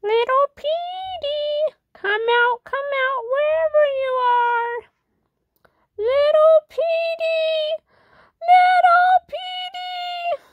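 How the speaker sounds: high, slow teasing call; creepy sing-song calling. Acted. Villain calls to hiding Petey.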